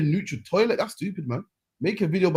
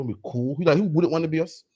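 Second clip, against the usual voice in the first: usual speaking voice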